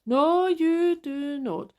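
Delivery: slow, loud, every word hit; emphatic no. Real, not acted. Ranting about slow refunds to listeners, slow and emphatic. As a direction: spell it out slowly and loudly.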